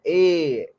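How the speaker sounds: ए held as a long "ay"; long vowel. Shown as a demonstration. Teacher showing students the long vowel sound, long vowel. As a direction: stretch ए out with weight on it. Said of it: "वो लंबा स्वर है".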